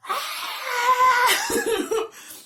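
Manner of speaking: long, high-pitched loud scream. Retold or imitated. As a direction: reenact screaming your head off. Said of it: "I started screaming my head off".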